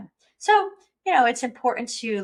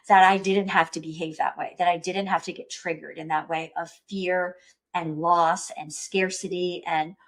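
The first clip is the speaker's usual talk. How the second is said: normal talking voice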